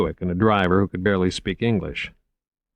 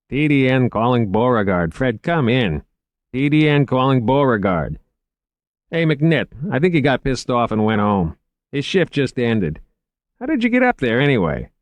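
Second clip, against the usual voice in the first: slightly raised radio hailing voice; radio dispatcher; 6 semitones higher, 4 dB louder than usual. Acted. Dispatcher calling Beauregard, then questioning McNitt, radio dispatcher. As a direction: hail the missing man over the radio.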